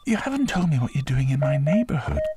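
put-on high-pitched young boy; Cocky. A narrator's reading. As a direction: pipe up as a boy questioning a new friend. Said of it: "Cocky said".

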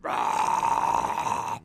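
low raspy breathy roar; dinosaur roar. Pretend. Host roars like a dinosaur for the beat. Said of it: "more of a dinosaur noise".

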